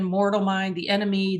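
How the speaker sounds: ordinary talk, nothing marked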